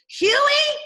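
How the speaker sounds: loud high call across the house. Shown as a demonstration. Father calling his son through the house, loud call. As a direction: call the boy's name loudly. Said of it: "he called".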